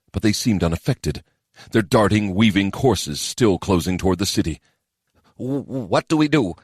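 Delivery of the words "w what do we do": a touch of a frightened stammer. A narrator's reading. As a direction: stumble on the first word. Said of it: "Kadan stammered".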